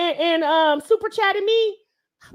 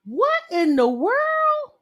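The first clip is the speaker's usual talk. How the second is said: high, drawn-out disbelief; astonished; 5 semitones higher, much slower than usual. Real, not acted. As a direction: stretch it out, real astonishment. Reacting to a surprise gift.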